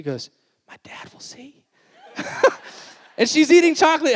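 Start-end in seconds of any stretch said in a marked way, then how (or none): 0.7-1.6 scared little whisper; hushed child's warning. Retold or imitated. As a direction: whisper like a child hiding from dad. Toddler hiding from dad.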